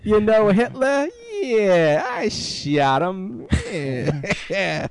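drawn-out braggart veteran voice; old-timey announcer voice. Pretend. Continues old war vet bit.

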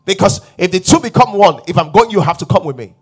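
loud, high-pitched sermon declaration; forceful preaching. Real, not acted. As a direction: preach it with mounting conviction and force.

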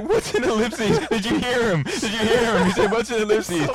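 high, loud hysterical-laughter impression; imitating Robert D. Co-host retelling how a podcaster cracked up, high and laughing. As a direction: squeal it through laughter, mocking. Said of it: "Robert starts laughing hysterically".

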